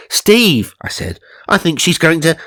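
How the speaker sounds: urgent rising alarm; alarmed warning. Retold or imitated. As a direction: warn him of the crash. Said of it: "car reversing into them".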